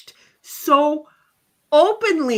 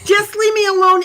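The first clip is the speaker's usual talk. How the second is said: louder and higher, fed up; mock exasperated outburst; 6 semitones higher, 7 dB louder than usual. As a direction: snap it out like a cornered host. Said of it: "I have people come over and go".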